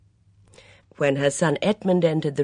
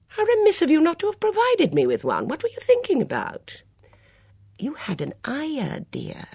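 put-on high, lively voice of a fussy older lady; a bright, gossipy mother at breakfast; chatty Mrs Swettenham; 7 semitones higher, 5 dB louder than usual. Swettenham exasperated.

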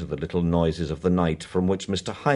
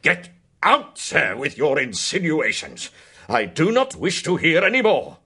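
loud raised angry order; 9 semitones higher, 6 dB louder than usual. Acted. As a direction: throw him out furiously. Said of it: "said Mister Bingham angrily".

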